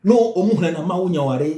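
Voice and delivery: ordinary talk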